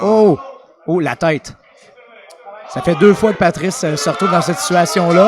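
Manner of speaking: loud excited commentary; excited shout. Real, not acted. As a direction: yell it as the action peaks. Commentator reacts to near submission.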